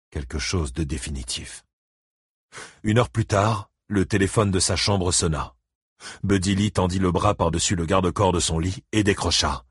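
ordinary delivery

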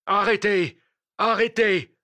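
high, loud command to halt; imperious shout. Acted. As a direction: cry out to stop them dead. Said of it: "une voix cria impérieusement".

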